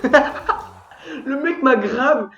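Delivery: slow words through high laughter; laughing while talking. Real, not acted. Gamer telling viewers how a player fell, laughing. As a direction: tell it while still cracking up.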